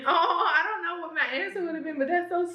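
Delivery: teary laugh. Real, not acted. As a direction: overcome and giggly. Still moved, laughing tearfully.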